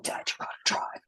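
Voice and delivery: whispered, in the grip of pain. Retold or imitated. Daughter in kidney-stone pain begging dad to drive, whispered. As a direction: breathe it out, barely able to speak.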